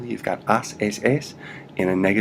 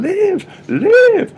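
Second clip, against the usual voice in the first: much higher, loud desperate cry of "vive"; mock scream; 11 dB louder than usual. Shown as a demonstration. Teacher hamming up a CPR scream at the patient. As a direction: scream it like saving a life. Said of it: "you're screaming out to them".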